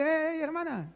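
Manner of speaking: high, drawn-out mock call; retold yell. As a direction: imitate hailing someone, without full volume. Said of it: "gritándole". (grito imitado, agudo y alargado)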